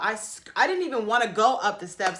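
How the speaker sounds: usual speaking voice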